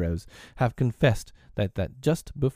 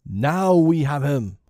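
slow, high, triumphant roar; loud villain's cry of victory; shout; 6 semitones higher, 6 dB louder, slower than usual. A narrator's reading. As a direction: shout it drawn out to the crew. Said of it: "Hook shouted".

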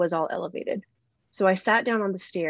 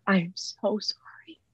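slow whispered apology; tearful whisper; slower than usual. Retold or imitated. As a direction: whisper it as if you can barely speak through tears. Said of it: "I'm saying to her".

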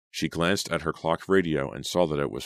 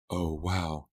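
usual speaking voice

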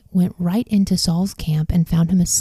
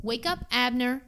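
higher, much softer mock shout; held-back shout; 5 semitones higher, 9 dB quieter than usual. A narrator's reading. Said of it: "Then he shouted to the soldiers".

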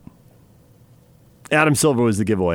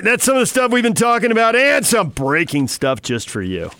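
usual speaking voice

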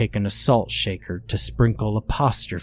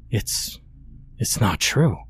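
shaky, stammering denial; trembling voice. A narrator's reading. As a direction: let the voice tremble with fear. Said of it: "my voice trembling as much".

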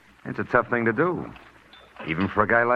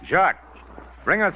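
calling out; 9 semitones higher than usual. Acted. Calling the waiter over.

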